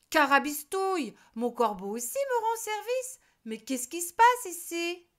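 high, loud baffled witch voice; a witch who can't believe her eyes; puzzled witch. A narrator's reading. Witch baffled by her helpful crow, high and loud. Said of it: "Elle se dit".

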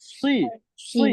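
সুই with a heavy, thick Arabic sound. Shown as a demonstration. Teacher showing students the thick letter sound. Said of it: "মোটা করে".

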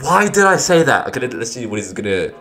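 ordinary delivery

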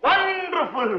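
high-pitched, delighted exclamation; gleeful cheering. Acted. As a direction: applaud the comeback in a high voice. Onlooker cheers the child's retort.

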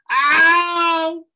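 long, very high, loud yelp; drawn-out howl of pain; pained cry. Acted. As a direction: shriek as if stung. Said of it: "cried Harrison".

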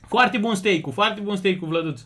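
ordinary delivery

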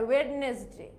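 ওয়েডনেসডে with D sounded, "wed-nes-day"; every written syllable of ওয়েডনেসডে sounded; common mispronunciation. Shown as a demonstration. Teacher voicing how many say Wednesday, spelled-out mispronunciation. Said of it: "অনেকে এটাকে কিভাবে উচ্চারণ করে?".

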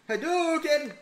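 game attack shout. Pretend.

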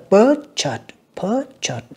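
"purchase" stressed on PUR, drawn out slowly; first-syllable stress. Shown as a demonstration. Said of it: "âm một nha các bạn". ("purchase" nhấn âm một, đọc chậm)

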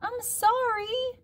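put-on very high, drawn-out child voice; little Harriet. A narrator's reading. Harriet apologizing to her mother, slow high child voice. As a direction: stretch it out in a tiny high voice. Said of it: "said Harriet".

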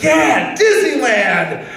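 shouted, high cheer of joy; yelling with friends, all pumped; excited cheer. Retold or imitated. Friends cheering on Disneyland morning, excited shout.

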